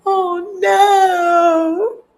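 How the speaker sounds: high, loud horrified oh no; shocked oh no. Real, not acted. Reacting to a scandalous line, loud horrified oh no. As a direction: cry out in scandalized disbelief.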